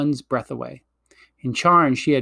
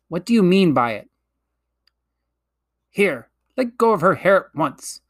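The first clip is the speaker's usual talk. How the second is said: sharp, raised demand; indignant demand; 4 semitones higher, 3 dB louder than usual. A narrator's reading. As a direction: order her to let go. Children order the witch.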